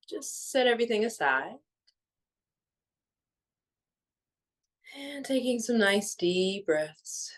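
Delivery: measured, soothing meditation voice with long pauses; guided meditation. Real, not acted. Guiding listeners to let go of the day, calm meditation voice. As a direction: ease listeners gently into stillness, phrase by phrase.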